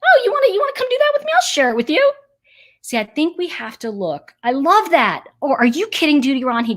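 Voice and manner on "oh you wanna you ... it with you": much higher, bright and louder, eager to share; cheerful welcoming. Pretend. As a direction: gush like an eager, friendly neighbor. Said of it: "American thinking is".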